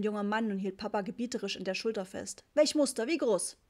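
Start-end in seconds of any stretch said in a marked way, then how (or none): 2.5-3.6 high, loud rapid-fire sales questions; pushy seller. A narrator's reading. Pushy young carpet seller. (aufdringlicher Verkäufer, laut und hoch)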